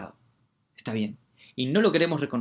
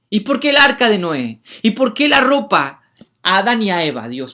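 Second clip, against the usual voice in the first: loud, raised rhetorical questions; fervent preaching; 6 semitones higher, 6 dB louder than usual. Acted. Preacher challenging the congregation, loud and fervent. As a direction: hammer the questions.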